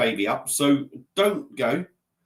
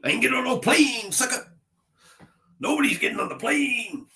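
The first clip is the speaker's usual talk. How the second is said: high-pitched comic tough-guy impression; put-on American voice, much higher, stretching the last word; Mister T; 10 semitones higher than usual. Shown as a demonstration. Streamer showing off a party-piece impression, mock American tough guy. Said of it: "I can do a Mister T".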